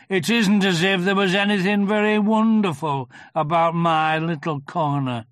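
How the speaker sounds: slow, loud, aggrieved donkey; Eeyore. A narrator's reading. Eeyore explaining his fall in the river, slow and aggrieved. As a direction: lay out the grievance ponderously.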